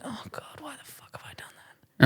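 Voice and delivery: whispered self-reproach; panicked whisper. Retold or imitated. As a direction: hiss it to yourself in panic. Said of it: "And I'm going".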